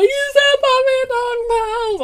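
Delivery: mock the child's tantrum with a drawn-out cry; whiny toddler wail. Imitating son's meltdown.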